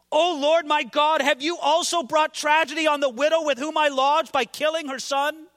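preacher voicing the prophet's impassioned plea; fervent cry to God. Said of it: "he prayed very strong words".

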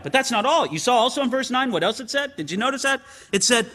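ordinary talk

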